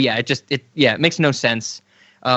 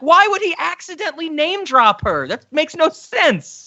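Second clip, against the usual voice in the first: high, incredulous mock outrage; mock exasperation; 12 semitones higher than usual. Fan mocking a movie's plot hole, high incredulous voice. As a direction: throw up your hands at the plot. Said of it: "And I'm like".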